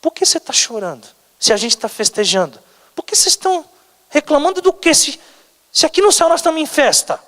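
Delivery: high-pitched, incredulous questioning; protesting from amid the party; puzzled celebrant. Acted. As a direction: ask in high-voiced bewilderment. Heaven's puzzled reply to weeping people.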